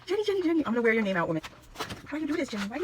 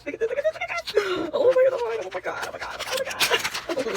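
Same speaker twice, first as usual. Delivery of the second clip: giddy laughing; 11 semitones higher, faster than usual. Real, not acted. Overjoyed unboxing, laughter heard.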